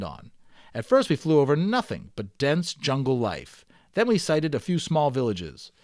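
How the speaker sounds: steady read-aloud storytelling voice; story narration. Acted. Teacher reading the balloon novel aloud to students, storytelling narration. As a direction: read it like a chapter book to the class.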